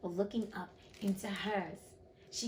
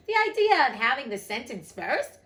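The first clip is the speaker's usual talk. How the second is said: loud, higher, outraged disbelief; loud protest; 4 semitones higher, 10 dB louder than usual. A narrator's reading. Alice objecting to the queen's verdict order, loudly. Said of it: "said Alice loudly".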